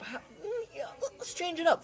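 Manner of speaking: ordinary delivery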